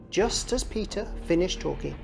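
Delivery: ordinary delivery, nothing marked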